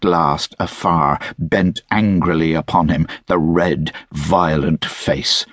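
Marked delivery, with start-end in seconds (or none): none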